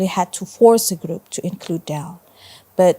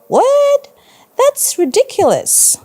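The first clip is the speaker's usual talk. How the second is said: very high, loud disbelief; exclaimed; 5 dB louder than usual. A narrator's reading. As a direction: burst out in loud, high-pitched incredulity. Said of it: "I exclaimed".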